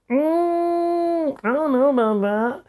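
high, doubtful hum; retold reluctance. Storyteller replaying their doubt to a partner, high and reluctant.

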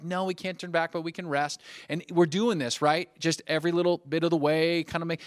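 lightly acted coaxing father; encouraging dad. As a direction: keep a flagging kid going. Father urging frustrated daughter on.

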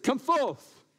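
booming summons; loud command. Acted. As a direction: call it out like a summons. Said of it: "He said".

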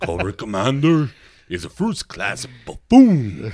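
loud, declaiming cartoon villain; Destro. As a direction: pronounce it with hammy villainous scorn. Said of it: "my favorite was always like imitating".

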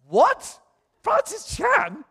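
high, slow, astonished repeat; stunned disbelief. Retold or imitated. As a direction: stretch the name in amazement. Stunned at the famous name.

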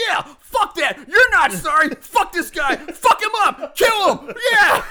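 egging him on, high, loud shouts; Eddie. Retold or imitated. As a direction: goad him on, building to a frenzied yell. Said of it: "Eddie starts egging him on".

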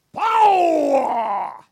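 loud drawn-out shouted power; Pentecostal preacher shout. Retold or imitated. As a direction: belt it like a revival preacher. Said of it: "how I used to hear it when I go to church".

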